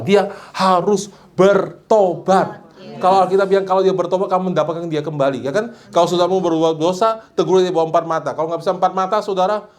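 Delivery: suara tinggi, berkhotbah penuh semangat; khotbah berapi-api. Acted. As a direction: berseru kepada jemaat dengan nada tinggi. Preaching at full force. (pendeta berkhotbah kepada jemaat, berapi-api)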